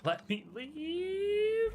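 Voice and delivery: high, long stretched-out wail; drawn-out wail. Pretend. Mock plea to end the stream.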